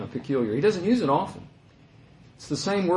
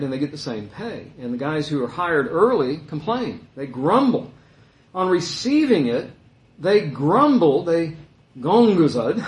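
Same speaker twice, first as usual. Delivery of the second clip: ordinary talk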